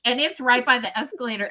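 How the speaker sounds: ordinary delivery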